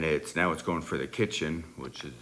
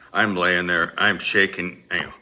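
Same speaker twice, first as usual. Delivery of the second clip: ordinary delivery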